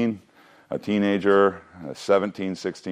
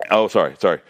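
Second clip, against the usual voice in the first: ordinary talk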